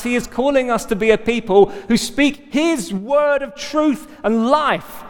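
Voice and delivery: high, driving preacher's exhortation; rally the congregation with mounting urgency; impassioned preaching. Real, not acted. Preacher calling the church to share in remaking, impassioned.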